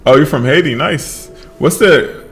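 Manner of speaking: ordinary delivery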